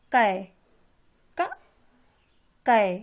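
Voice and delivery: teacher sounding out कै; ai syllable demo. Shown as a demonstration. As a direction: punch out कै after a bare क. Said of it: "इसमें ऐ की ध्वनि निकल रही है".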